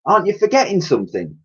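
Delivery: a quick teasing nudge; Dottie's voice. Acted.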